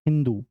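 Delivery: हिंदू with an h where सिंधु has s; teacher showing the Persian हिंदू; Persian pronunciation. Retold or imitated. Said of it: "सिंधु को इन्होंने बोला".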